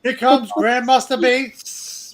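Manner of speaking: lightly voiced slow ring announcer; mock announcer. Joking with friends about a fighter's name, mock announcer.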